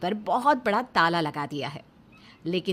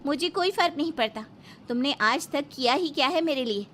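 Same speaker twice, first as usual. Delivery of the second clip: high, raised, scornful scolding; angry retort; 6 semitones higher than usual. Acted. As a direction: tear into your useless husband. Wife angrily retorts to husband.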